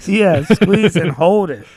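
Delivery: loud, high coaching bark; loud gym coach. Pretend.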